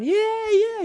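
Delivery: high, loud frog cheers; cheering. A narrator's reading. Frogs cheering on the boy, excited whooping. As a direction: cheer on the driver in a squeal. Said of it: "they were cheering him on".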